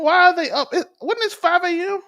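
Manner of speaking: high, loud disbelief; can't believe it, voice jumps up; incredulous. Real, not acted. Shocked at kids up early.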